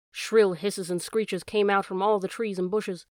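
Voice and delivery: ordinary delivery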